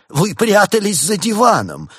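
high, loud, slow outcry; shocked exclamation. A narrator's reading. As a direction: recoil with a drawn-out accusing cry. Doctor startled by hidden dealer.

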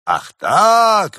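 high, loud outraged exclamation; outraged shout. A narrator's reading. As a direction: explode at the insult. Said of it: "воскликнул отец обожаемый". (возмущённый крик, очень громко)

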